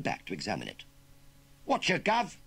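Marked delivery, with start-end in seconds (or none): 1.6-2.4 high, loud cheeky Cockney urchin; a cocky London street kid sizing up the farmer; perky Cockney voice. Acted. Urchin greets farmer in perky Cockney accent. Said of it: "at the perky Cockney voice".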